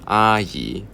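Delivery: 阿姨 said as level tone, then rising tone; first and second tone. Shown as a demonstration. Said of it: "姨 is second tone".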